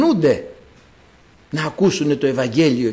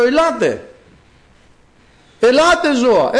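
high, ringing acted summons; calling out; 8 semitones higher, 4 dB louder than usual. God summoning the animals, calling out. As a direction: call the animals in, pitched well up. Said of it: "Φωνάζω".

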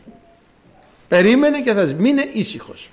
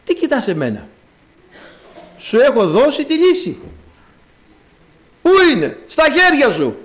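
the Lord rebuking; 4 semitones higher than usual. Acted. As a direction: press him hard, almost scolding. God answering Moses sternly.

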